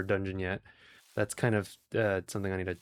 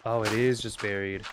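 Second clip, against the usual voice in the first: usual speaking voice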